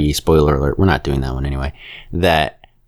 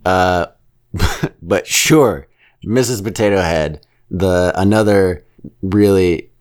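ordinary talk, nothing marked

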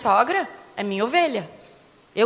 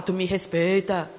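lightly imitated stern husband, low and slow; mock stern; 6 semitones lower, slower than usual. Wife imitates husband demanding respect, mock stern. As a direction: drop low and draw out the warning.

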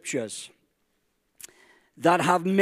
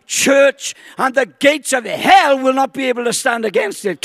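excited; 6 semitones higher, 6 dB louder than usual. Real, not acted. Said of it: "I'm getting excited just reading out".

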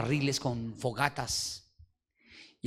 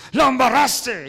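high, loud furious rebuke; harsh scolding; 11 semitones higher, 14 dB louder, slower than usual. Retold or imitated. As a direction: bark it at him like a stern leader. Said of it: "me habló durísimo".

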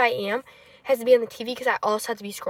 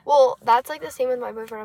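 ordinary talk, nothing marked

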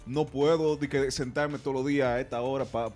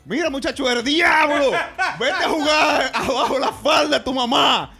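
put-on high, loud scolding old lady yelling out the window; angry old neighbor; 11 semitones higher, 9 dB louder, slower than usual. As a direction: shriek like a furious neighbor. Voicing angry neighbor Chencha. (vecina furiosa gritando)

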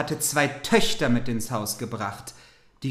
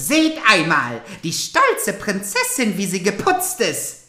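loud, higher sneering taunt; mocking stepsister; 5 semitones higher, 5 dB louder than usual. Stepsisters taunting Cinderella, loud mocking voice. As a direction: jeer at the proud princess.

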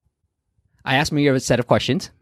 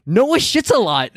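blurted line; 12 semitones higher, slower than usual. Retold or imitated. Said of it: "my wife, Asha's like".

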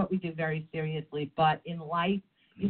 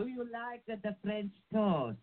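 put-on accent, the French toast; 6 semitones higher, 5 dB quieter than usual. Shown as a demonstration. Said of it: "no, no, you gotta say".

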